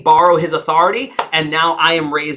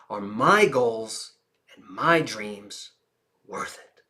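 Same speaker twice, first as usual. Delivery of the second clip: much softer, slow, ending in a whisper; hushed intimate question; quiet, slow; 7 semitones lower, 8 dB quieter, slower than usual. Shown as a demonstration. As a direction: drop to a near whisper. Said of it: "Listen to the volume of my voice".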